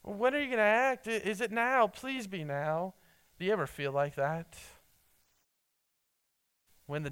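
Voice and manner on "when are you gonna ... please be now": higher, pressing plea to God; imitating an anxious plea. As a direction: beg God to act now, higher. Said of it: "he's really asking that question".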